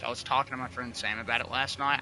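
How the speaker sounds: ordinary delivery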